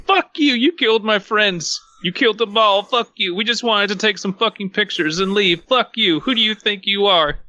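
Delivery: high-pitched, outraged yelling. A narrator's reading. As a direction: yell your fury up at the ceiling. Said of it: "yelling to the ceiling".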